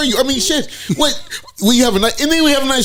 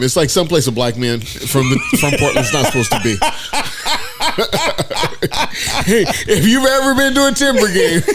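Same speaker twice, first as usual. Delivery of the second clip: cracking up mid-sentence; can't get through it for laughing; laugh-broken punchline; laughing through the joke; 4 semitones higher than usual. Real, not acted.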